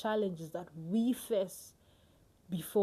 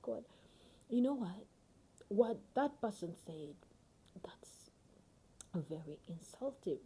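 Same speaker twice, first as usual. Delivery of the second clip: hushed prayer; 8 dB quieter than usual. Retold or imitated. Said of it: "I was like".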